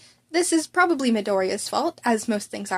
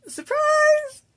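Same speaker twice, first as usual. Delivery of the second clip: much higher, bright cheeky reveal; gleeful surprise; 5 dB louder than usual. Acted. Student cheekily revealing his secret, gleeful. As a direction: sing it out like a party popper.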